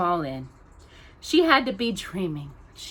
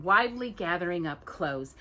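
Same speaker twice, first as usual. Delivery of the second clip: plain reading voice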